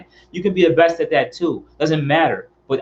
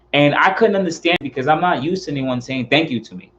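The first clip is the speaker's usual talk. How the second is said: usual speaking voice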